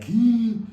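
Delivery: high, loud hammed-up crying hum; mock a big wailing sob; loud sob whimper. Shown as a demonstration. Showing the dramatic crying he did not do, mock sob. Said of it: "Not that crying of like".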